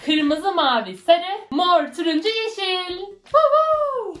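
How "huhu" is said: high cheerful whoop; excited whoop. Real, not acted. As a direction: cheer with delight. Cheering over new crayons.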